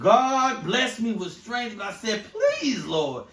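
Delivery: very slow, drawn-out mock sermon; old school preacher. Imagined pastor dad praying for patience, old school preacher voice. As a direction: stretch each phrase from the pulpit. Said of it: "old school preacher".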